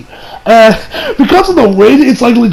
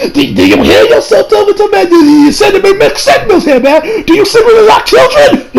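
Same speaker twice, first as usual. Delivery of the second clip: much higher, cracking up while protesting; laughing mock outrage; 13 semitones higher, 4 dB louder than usual. Reviewer scolds Tobita over the date remark, laughing mock outrage. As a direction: scold him in squealed disbelief through laughter.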